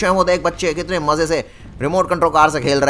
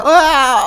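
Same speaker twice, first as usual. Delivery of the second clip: pained cry; 12 semitones higher, 8 dB louder than usual. Real, not acted. Thrown, back hurts.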